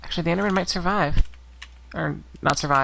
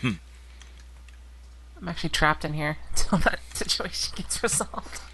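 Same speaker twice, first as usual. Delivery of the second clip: laughing; 4 dB quieter, slower than usual. Real, not acted. Laughs at being trapped.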